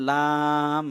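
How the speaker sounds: লাম held very long on one level note; long drawn-out vowel. Shown as a demonstration. Teacher showing students the letters inside lam, long drawn-out vowel. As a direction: stretch the aa of লাম steadily before closing on m. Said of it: "লাম উচ্চারণ করলাম".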